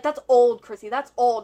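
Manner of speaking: insistent retold denial. Boyfriend brushing off old screenshots, insistent. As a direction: push back loudly, acting out his denial.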